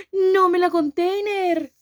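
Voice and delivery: loud, high, slow cry of disbelief; disbelieving shout. Real, not acted. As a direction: yell it out, stunned. Reacting to the story twist.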